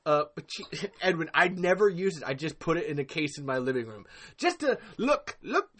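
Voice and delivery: normal talking voice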